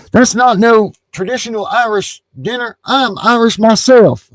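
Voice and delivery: friend's Southern voice, mocked; mock Southern accent. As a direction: imitate a Southern guy claiming to be Irish. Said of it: "You sound like a Southerner".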